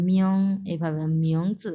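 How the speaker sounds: মিয়ং rising as a second tone, জি light; delicate pronunciation. Shown as a demonstration. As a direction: lift মিয়ং gently upward. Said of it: "খুবই সূক্ষ্মভাবে উচ্চারণ করব".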